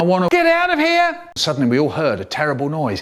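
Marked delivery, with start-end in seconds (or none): 0.0-1.2 very high-pitched cry; desperate outburst. Acted. Prisoner in for life, high desperate outburst. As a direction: burst out, frantic to escape. Lifer demands to escape.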